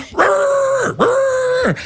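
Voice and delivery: high yelping bark, hammed up; mock alarm barks to wake the sleepers; dog barking. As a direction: bark like a frantic guard dog. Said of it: "like a dog barking".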